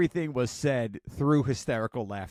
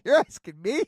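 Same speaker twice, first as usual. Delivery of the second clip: higher, louder, rising; incredulous teen; 8 semitones higher, 6 dB louder than usual. Retold or imitated. Son incredulous at his mom's question, high and rising. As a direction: act his baffled comeback. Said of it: "He says".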